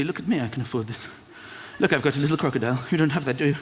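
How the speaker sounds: smug show-off. Retold or imitated. Designer-label wearer showing off his logo, smug. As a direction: gloat about the little crocodile. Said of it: "People wear designer clothes to say".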